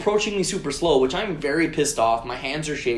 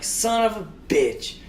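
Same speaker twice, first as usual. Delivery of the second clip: drawn-out, higher-pitched curse; exasperated cursing; 6 semitones higher, slower than usual. Retold or imitated. Driver curses after bumping the car, retold exasperation. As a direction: stretch it out in mock exasperation.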